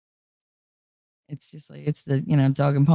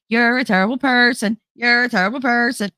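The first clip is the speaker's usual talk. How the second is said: higher nagging inner voice, pushed louder; loud inner accusation; 7 semitones higher than usual. Retold or imitated. Her own brain berating her at night, loud nag. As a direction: hammer herself with the words. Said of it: "loud as hell".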